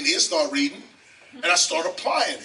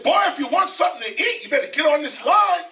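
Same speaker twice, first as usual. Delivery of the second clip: loud, higher-pitched bark of a stranger; gruff shout; 10 semitones higher, 5 dB louder, faster than usual. Retold or imitated. Shelter stranger warning a hungry boy, loud and gruff. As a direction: call it out like a man in a food line. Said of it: "The man turned and he said".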